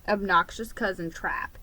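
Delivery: usual speaking voice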